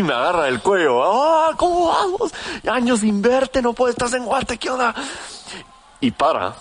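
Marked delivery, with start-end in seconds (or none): 1.0-4.9 put-on drunk friend shouting. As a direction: bellow it like a happy drunk at a party. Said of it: "borrachísimo".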